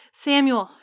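high, loud shout of a name; desperate call. Acted. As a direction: call to the lost son in the dark. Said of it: "her father called".

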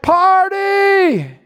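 high, drawn-out party cry; long, high cheer; celebrating shout. Acted. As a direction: whoop it out like a crowd going wild. Imitates celebrating crowd's total mayhem.

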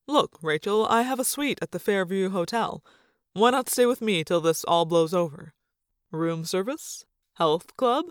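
hint of a coaxing father; lightly voiced dad. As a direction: lightly play a father making an inviting offer.

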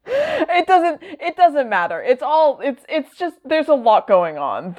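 high-pitched, giggly exasperation; real amused exasperation, pitched high; flustered giving up. Real, not acted. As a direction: throw up the explanation in a high voice. Gives up explaining, laughing just before.

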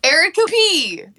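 giggling through the greeting; laughing. Real, not acted. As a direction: laugh between words. Jokey name greeting, laughing.

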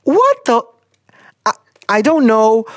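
high, sharp shocked outburst. Real, not acted. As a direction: blurt it out in shock. Shocked by letter.

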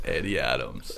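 deep, flat fake-name intro; deadpan low introduction.

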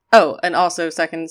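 ordinary talk

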